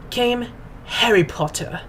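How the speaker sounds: slow, weighty pause before the name; slow grand announcement; dramatic reveal. Acted. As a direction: land it like an epic twist. Dramatic reveal in mock reading.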